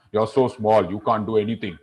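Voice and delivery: low, dismissive lion voice; deep scoff from a big lion; baritone lion. Acted. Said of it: "I was going in a ... Baritone voice".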